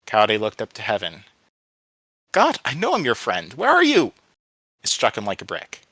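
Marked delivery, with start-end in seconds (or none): 2.3-4.1 building from raised plea to high, loud cry; plead, then cry out for an answer; desperate appeal. A narrator's reading. Boy calling up to heaven, desperate appeal.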